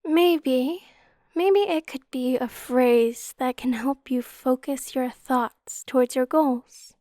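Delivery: ordinary talk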